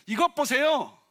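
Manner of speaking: elder son confronting his father, loud and defiant; defiant outburst. Retold or imitated. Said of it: "굉장히 아버지의 권위에 도전하는 말입니다".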